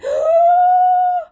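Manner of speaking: loud, long, high sucked-in breath; big inhaling gasp. Acted. Storyteller with children taking a big breath. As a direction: suck in a huge breath.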